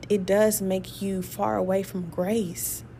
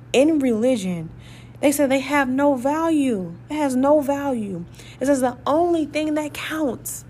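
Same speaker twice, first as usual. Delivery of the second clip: preached emphasis; 5 semitones higher, 4 dB louder than usual. Real, not acted. Preaching at full force.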